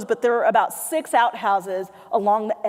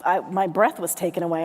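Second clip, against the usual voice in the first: ordinary delivery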